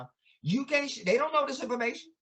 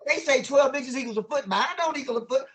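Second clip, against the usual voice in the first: ordinary talk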